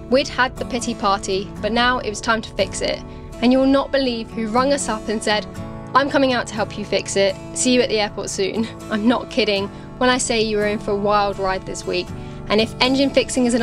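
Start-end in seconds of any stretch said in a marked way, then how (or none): none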